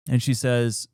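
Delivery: ordinary delivery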